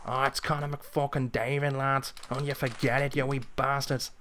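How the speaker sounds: a hint of Irish pub swagger in the Irish accent; mock Irish trash talk to the lads. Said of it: "saying it in an Irish accent".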